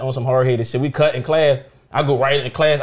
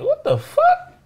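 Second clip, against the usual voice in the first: shocked; slower than usual. Retold or imitated. Waking to viral phone.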